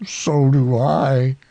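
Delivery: simple, drawn-out reply; Pooh. A narrator's reading. Bear answering the boy who loves him, slowly.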